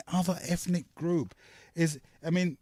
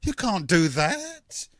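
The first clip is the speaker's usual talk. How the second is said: ordinary talk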